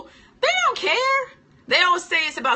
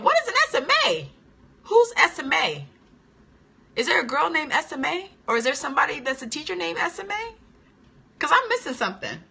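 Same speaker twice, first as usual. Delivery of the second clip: confused questioning; 6 semitones higher than usual. Retold or imitated. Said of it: "I kept saying".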